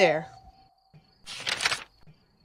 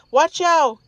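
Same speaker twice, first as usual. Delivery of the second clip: high, loud yelp of warning; 6 semitones higher, 3 dB louder than usual. A narrator's reading. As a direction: yelp as the brakes slam. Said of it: "yelped Mom".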